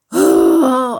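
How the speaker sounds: a low, loud, exasperated groan; a big groan of teenage frustration; frustrated groan. Acted. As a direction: let out a heavy fed-up groan. Frustrated boy bursting out.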